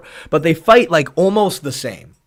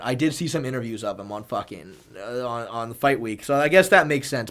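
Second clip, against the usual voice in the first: ordinary talk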